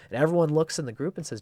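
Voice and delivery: ordinary delivery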